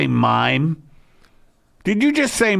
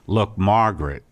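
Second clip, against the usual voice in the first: ordinary delivery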